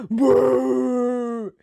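loud, long bellowed wail; mock bawl. A narrator's reading. Storyteller as Moncho in a tantrum, bawling. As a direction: bawl it out on one held note.

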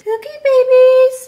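high, loud, drawn-out coo; mock baby-talk cooing. Teasing partner about his cookie babies, exaggerated high cooing. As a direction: gush slowly as if over babies.

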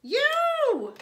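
high, loud yoo-hoo call; sing-song witch call. A narrator's reading. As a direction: call out across the meadow. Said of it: "said Gretch to the cow".

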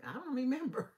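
high, laughing I don't remember; laughing denial. Retold or imitated. Counselor imitating family members who claim not to remember, laughing. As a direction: feign forgetting with a laugh. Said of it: "bring up things like".

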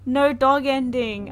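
slow, loud, falling lament; mock lament. Real, not acted. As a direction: drag it out like a heartbroken wail. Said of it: "I'm gonna cry".

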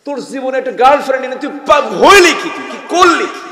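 loud, high, laughing taunt; mocking friend. Youth mocks his friend for having no girlfriend, taunting loudly. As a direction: ridicule him for being single. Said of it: "যুবক যুবক গল্প করে".

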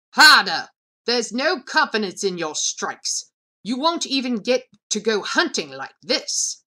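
loud scolding of a struggling trainee; scolding shout. Acted. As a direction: yell at her to hit harder. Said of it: "the overseer's scolding".

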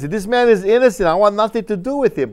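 high, loud declaration of innocence; loudly wash your hands of him; Pilate protesting. Acted. Pilate voiced washing his hands.

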